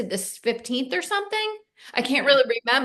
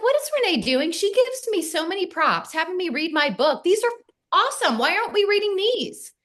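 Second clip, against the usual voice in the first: much higher, excitedly amazed retelling; excited amazement; 9 semitones higher than usual. Retold or imitated. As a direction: gush with delighted surprise. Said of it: "And I was like".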